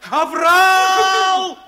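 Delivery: high, loud alarm call; shout. Acted. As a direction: yell it to wake everyone. Rousing a sleeping comrade.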